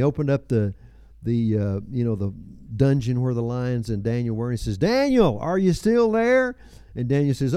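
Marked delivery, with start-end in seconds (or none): 4.8-6.6 high, drawn-out call down into a pit; acted king calling out from above. King calls down to Daniel in the den, calling out.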